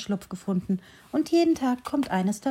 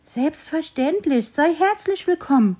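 joyful tree welcome; 7 semitones higher than usual. A narrator's reading. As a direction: welcome the squirrel gladly. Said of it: "rief das Bäumchen und freute sich sehr". (freudig willkommen heißendes Bäumchen, höher und langsam)

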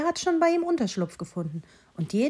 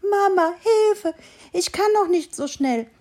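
panting little voice; 9 semitones higher than usual. A narrator's reading. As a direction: gasp it out like a worn-out fawn. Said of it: "keuchte das Stimmchen".

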